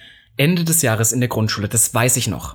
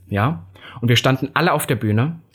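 ordinary talk

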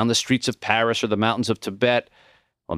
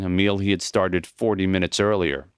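usual speaking voice

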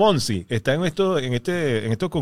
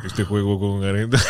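low-pitched mock Chilean announcer; 9 semitones lower than usual. Comedian repeating the Chilean bit on request, announcer voice. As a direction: drop into a deep broadcaster's voice. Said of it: "pero con voz de locutor".

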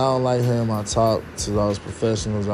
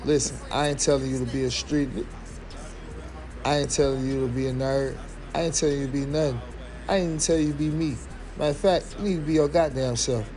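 fast street hustling; 4 dB quieter, faster than usual. Real, not acted. Rapid building street advice rant.

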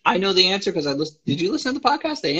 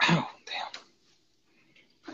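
whispered; 11 dB quieter than usual. Real, not acted. Reaction to big number.

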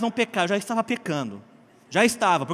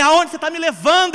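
loud, high furious shout; yelling at the GPS; 10 semitones higher, 10 dB louder than usual. Retold or imitated. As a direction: scream the question at the machine. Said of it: "Eu gritei com ele".

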